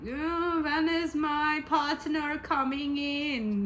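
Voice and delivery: high, drawn-out German accent impression; German comedian accent. Teasing viewers impatient for a partner, imitated German comedian accent. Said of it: "that German stand-up comedian".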